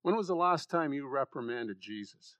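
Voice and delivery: ordinary delivery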